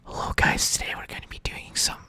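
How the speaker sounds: whispered, fast mock intro. Parodying youtuber intro, whispering. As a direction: whisper it like ASMR.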